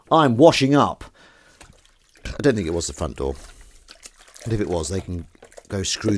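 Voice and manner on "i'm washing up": loud reply from the sink; calling back. Real, not acted. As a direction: shout it back across the house.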